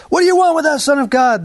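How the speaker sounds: high, loud demon cry; possessed men screaming at Jesus; demons shouting. Acted. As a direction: shriek it at the Son of God. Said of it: "they shouted".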